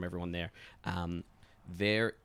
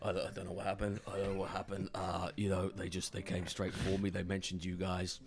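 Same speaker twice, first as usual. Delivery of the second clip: shaken Decker; 6 dB quieter than usual. Acted. Beaten-up Decker reporting to the gang, shaken and subdued. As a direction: softly stammer it out, repeating yourself. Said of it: "he comes on in and he goes".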